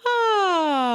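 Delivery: high, loud released sigh on ah; open sigh. Shown as a demonstration. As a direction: let the sigh fall out freely. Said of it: "And you can sigh".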